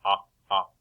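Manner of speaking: o- clipped as a short open ah; short o sound. Shown as a demonstration. Teacher sounding out short o for the class, short ah. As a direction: repeat the ah sound slowly. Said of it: "that is the short O sound".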